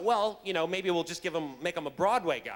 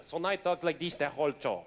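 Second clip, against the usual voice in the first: put-on camp Spanish accent; 3 semitones lower than usual. Shown as a demonstration. Actor showing Jace's combo voice, camp Spanish accent. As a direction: play a Spanish dancer with a thick accent. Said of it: "a Spaniard".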